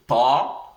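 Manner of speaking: "ta" with a heavy t from the throat; heavy, throaty "ta"; emphatic letter. Shown as a demonstration. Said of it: "They come directly from the throat".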